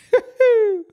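sudden high-pitched laugh. Real, not acted. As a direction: burst out laughing at yourself. Laughs at his own boasting.

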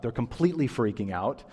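ordinary talk

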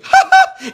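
high squeaky mock giggle; imitating friend's laugh. Pastor mimicking roommate laughing at a joke, high squeaky giggle. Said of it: "you'd make him laugh".

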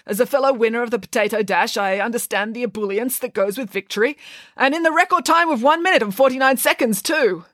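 high, emphatic vice principal proclaiming a victory; grand, high-voiced congratulation to a race winner; pompous vice principal. A narrator's reading.

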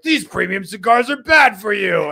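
slow, loud, mock public-warning voice; hammed-up warning slogan; mock warning shout. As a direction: boom it out like a grave health warning. Mock warning headline read aloud.

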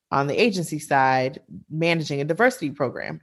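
ordinary delivery

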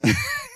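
short high burst of laughter. Real, not acted. Pastor laughing at his own prank story.

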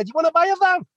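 very high mock voice of the stranger; crappy accent. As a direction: squeak it out like the neighbour. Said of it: "Sorry about my crappy accent".

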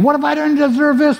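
2 high, whiny protest at being sent into trouble; 3 mock a believer feeling wronged; 1 self-pitying complaint. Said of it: "We're thinking".

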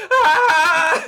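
high laughing yelp of fright; a startled squeal, half laughing; mock scream. Caught by Ian, a high laughing scream.

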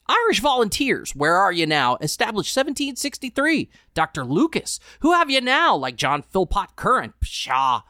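read-aloud rant with loud, rising peaks; editor's ranting cry. A narrator's reading. Editor scorning the present at the newsroom, half-shouted. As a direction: declaim like a pompous old editor.

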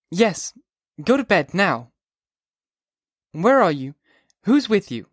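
put-on high, sharp girl's voice; a girl woken by a drunk caller; young woman Sally. A narrator's reading. Sally answers drunk Holden, annoyed.

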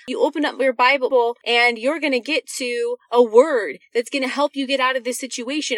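ordinary talk, nothing marked

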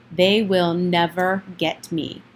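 usual speaking voice

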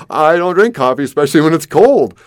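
amused loud retort; laughing disbelief. Retold or imitated. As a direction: laugh at the absurd offer. Laughing disbelief at coffee offer.

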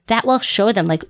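usual speaking voice

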